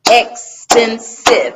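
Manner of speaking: "expensive" slow and loud, EX, PEN, SIVE; three separate syllables. Shown as a demonstration. Teacher counts syllables for students, "expensive" in three slow separate beats. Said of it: "that's three".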